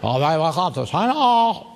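lightly voiced foreign dignitary; a hint of a fawning host greeting; Chinese Prime Minister. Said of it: "Prime Minister is saying".

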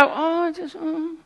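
embarrassed dodge of a street evangelist; awkward mumble. Retold or imitated. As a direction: squirm out of the conversation. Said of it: "And you go".

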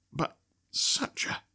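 slow, breathy whisper. A narrator's reading. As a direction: lean in and whisper it. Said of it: "speaking in a confidential voice".

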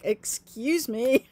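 slow, pointed excuse me; indignant. Retold or imitated. Crying patient calling out to gawking staff, slow and indignant. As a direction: call out the staff, drawn out. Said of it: "And I'm like".

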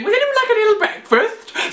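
chirpy high mock of a helpful woman; breakfast lady. Imitating the woman handing out breakfast, high and sweet. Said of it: "food bags going".

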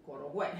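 CORAL WAY as "Coral Gway", G hit harder. Shown as a demonstration. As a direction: imitate a Spanish speaker's CORAL GWAY. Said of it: "muchos hispanos dicen Coral Way".

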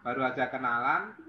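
ordinary delivery

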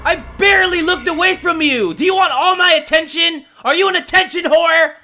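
high furious shouting; angry yelling. Real, not acted. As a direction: yell at her in frustration. Yelling at game character.

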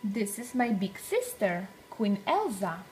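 plain reading voice

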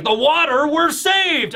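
high, excited cry of relief; voicing a thirsty fighter, high and thrilled. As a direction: gasp it out like you're saved. Voicing Killer seeing water.

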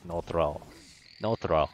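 lightly put-on Russian accent; terse broken English, hint of mock Russian accent; a touch of fake Russian accent. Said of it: "Wait, am I Russian?".

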